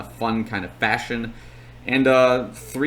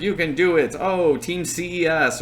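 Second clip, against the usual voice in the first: higher-pitched imitation of megaphone cheering; mild mock yell; 6 semitones higher than usual. Megaphone guy cheering passing runners, mock yelling. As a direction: lightly imitate a race cheerleader's call. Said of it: "yelling some fun, like, typical things".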